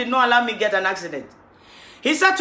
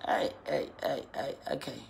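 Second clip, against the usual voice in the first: whispered; 11 semitones lower, 16 dB quieter than usual. Real, not acted. Testing a phone line.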